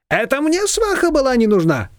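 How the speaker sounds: high, combative neighbour voice; militant Shushana. A narrator's reading. Shushana snapping back at Nina, high and combative. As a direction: fire it back, pitched high. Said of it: "воинственно сказала Шушана".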